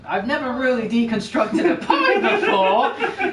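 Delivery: chuckling through a first try; amused musing. Pretend. Player's character tries deconstructing a pie, laughing. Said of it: "and you're like".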